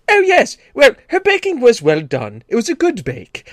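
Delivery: very high, prim mock-English praise; faint praise in a fussy English voice; hammed-up polite English baker; passive-aggressive English. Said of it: "if somebody says".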